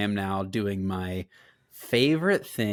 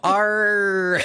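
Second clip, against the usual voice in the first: high, loud, laughing pirate "Arr"; 11 semitones higher, 5 dB louder than usual. Acted. Co-host answers the crab joke with a pirate "Arr". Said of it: "Not enough R's".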